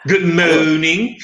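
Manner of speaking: comic bad-French policeman, loud and drawn-out; Officer Crabtree. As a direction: ham up mangled French-accented vowels, slowly. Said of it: "the Officer Crabtree is that".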